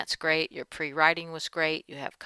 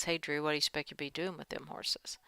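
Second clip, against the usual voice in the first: whispered reading; 5 dB quieter, faster than usual. Shown as a demonstration. Teacher murmurs a student's dialogue line to herself, whispered. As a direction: read the line in a soft unvoiced whisper.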